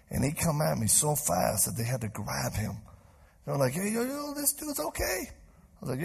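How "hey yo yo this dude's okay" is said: high mock call. Friends holding back violent man.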